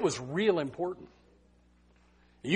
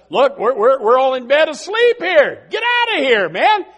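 loud, high protest of a roused sleeper; mock irritated yell; 6 semitones higher, 8 dB louder than usual. Sleeper sends away the friend at his door, mock irritated yell. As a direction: act out annoyance, climbing to a yell.

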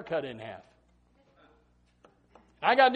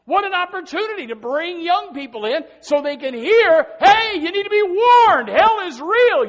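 high, loud pulpit cry rising to a shout; slowed, shouted sermon warning; full-force preaching; 11 semitones higher, 12 dB louder than usual. Real, not acted.

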